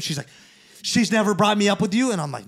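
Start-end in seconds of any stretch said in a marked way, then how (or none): 0.8-2.1 higher, louder mock disbelief; indignant question. Girlfriend questions the storyteller about the phone call, imitated indignation. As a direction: imitate an affronted question, rising at the end.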